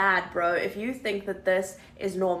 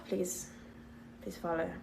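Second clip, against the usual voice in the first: fading sign-off; 4 semitones lower, 14 dB quieter than usual. Real, not acted.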